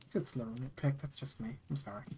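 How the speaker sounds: ordinary delivery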